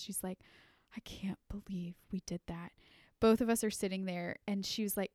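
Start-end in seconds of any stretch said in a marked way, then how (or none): 0.9-2.7 hushed, guilty whisper; tearful whisper. Retold or imitated. Sister whispering to her about the prank, tearful. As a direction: whisper it as if close to tears. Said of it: "she's in tears".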